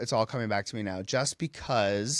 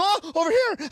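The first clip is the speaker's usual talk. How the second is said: high, loud imitated squeal; excited exclamation; 5 dB louder than usual. Star reacting to the fragrance, excited exclamation. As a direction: mimic her calling out in delight.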